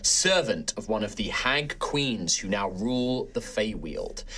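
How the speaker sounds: measured storyteller recap; story recap narration. A narrator's reading. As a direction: narrate the recap over music. Recap narration over music.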